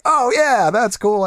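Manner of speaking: high, pleased exclamation; acted approval. Reenacting liking some things in the game, high pleased approval. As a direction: reenact delighted discovery, pitch lifted. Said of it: "some things like".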